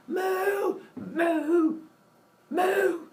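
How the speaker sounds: very high anguished moo; dying cow moo. Acted. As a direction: moo out the cow's death cries. Cow sacrificed.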